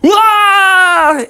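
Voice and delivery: high, loud wail; falling scream. Acted. Clown falls from a breaking trapeze, high scream. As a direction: yell high as you drop.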